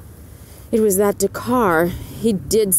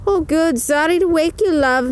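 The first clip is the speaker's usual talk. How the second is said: much higher, louder character voice; Missus O'Malley voice; 9 semitones higher, 3 dB louder than usual. A narrator's reading. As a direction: lift the pitch way up and brighten. Missus O'Malley phoning Peg.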